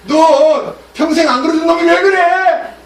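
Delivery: high, loud, slow scolding; scolding rebuke. Acted. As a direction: drag out the angry scolding. Balaam scolds the donkey.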